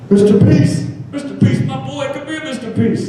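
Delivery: put-on excited little kid. First grader calling across cafeteria.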